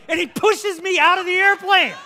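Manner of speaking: loud, high-pitched storytelling; shouted climax. Retold or imitated. Pastor telling how he was pushed from the plane, shouted climax. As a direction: keep yelling through the punchline.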